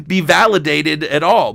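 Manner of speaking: ordinary delivery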